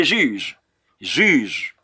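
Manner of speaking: Juge said "zhuzh"; French pronunciation. Shown as a demonstration. Teacher models the book for learners, Juge as "zhuzh". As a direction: Juge with soft French zh at both ends. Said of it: "Let me say Juge".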